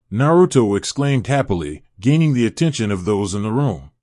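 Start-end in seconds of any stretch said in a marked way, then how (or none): none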